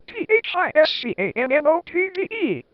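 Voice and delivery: high, spelled-out letters in a hammed-up shout; mock angry shout. A narrator's reading. Ministry official yelling at the headmaster, hammed-up shouted spelling. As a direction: spit each letter out like a furious teacher. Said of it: "she shouted angrily".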